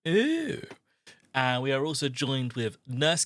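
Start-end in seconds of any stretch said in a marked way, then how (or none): 0.1-0.6 impressed ooh. Real, not acted. Reacts to villainous witch intro.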